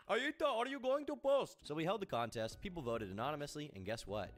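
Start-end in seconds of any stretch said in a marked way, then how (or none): 0.1-1.6 calling mom voice. Pretend. Mother calling child voice.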